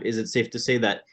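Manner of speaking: ordinary talk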